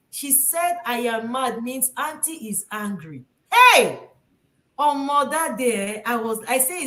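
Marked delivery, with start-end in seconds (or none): 3.5-4.0 sudden loud Hey!; loud exclamation. Real, not acted. Recalling the misunderstanding, loud Hey. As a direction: burst out in disbelief.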